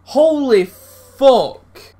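slow, high, loud groan of disbelief; drawn-out outraged cry. Real, not acted. Gamer reacting to the penalty call, slow outraged cry. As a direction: stretch it out in outrage.

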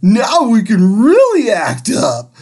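high, loud and mischievous; gleeful kids. Acted. Children cheer at the empty desk, high and gleeful. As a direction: kids plotting chaos, delighted. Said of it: "yelled the kids".

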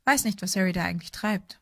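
usual speaking voice

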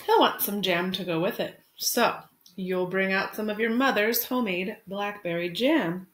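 gentle picture-book read-aloud; expressive storytime narration; reading to children. Acted. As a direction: read it like storytime for your class. Teacher reads picture book aloud.

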